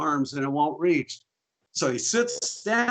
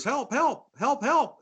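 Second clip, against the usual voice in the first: cry for help; 9 semitones higher, slower than usual. Acted. Elephant calling for help in joke.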